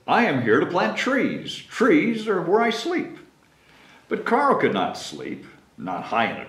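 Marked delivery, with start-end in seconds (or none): 0.1-3.2 squirrel declaring. Acted. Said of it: "The squirrel declared".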